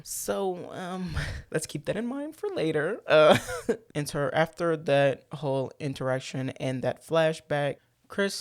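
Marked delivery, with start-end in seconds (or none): none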